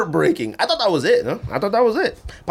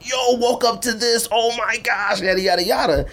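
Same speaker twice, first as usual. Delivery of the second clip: mock-excited online reaction, pitched well up and slowed; excited quote; 8 semitones higher than usual. Imitating fans online, pitched up and excited. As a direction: gush like a thrilled fan posting.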